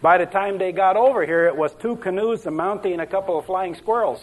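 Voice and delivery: a light Scandinavian lilt for Sven; lightly voiced Norwegian accent. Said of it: "I better stick with the Ole and Sven".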